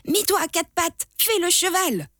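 put-on high, bossy little kid; bossy kid order. A narrator's reading. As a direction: order it like a spoiled child. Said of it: "ordonne-t-il".

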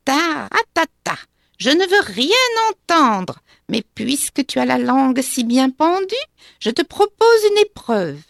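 a sour little witch, mocking; shrill spiteful witch. A narrator's reading. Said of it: "La sorcière me répond". (voix de sorcière pointue, aigre et méchante)

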